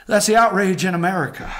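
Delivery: normal talking voice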